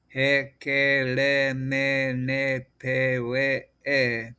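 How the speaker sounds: "ha ka la ma" and each row after it slowly chanted on a level note; hakalama recitation. Shown as a demonstration. Teacher practising the vowels using the hakalama, slow level syllable chant. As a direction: recite the syllables evenly, every vowel held long. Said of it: "and using the hakalama".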